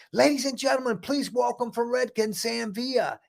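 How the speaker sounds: high-pitched, projected emcee introduction; show announcer. Retold or imitated. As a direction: announce the star act to the whole room. Re-enacts his own stage introduction.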